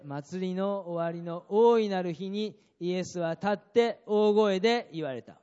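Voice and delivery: 祭りの終わりの大いなる日に read slow and loud, each word weighted; loud scripture reading. Shown as a demonstration. Pastor leading the congregation in reading, slow and loud. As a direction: proclaim the verse slowly to the hall. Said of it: "大声で言いましょう".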